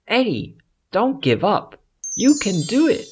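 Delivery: high, bright fairy voice cheering him on; a butterfly fairy urging a frightened boy on; encouraging fairy. Acted. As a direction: lift him up like a tiny magical friend. Fairy encourages scared Eddie.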